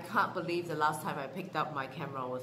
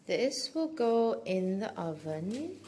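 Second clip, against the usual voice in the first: ordinary talk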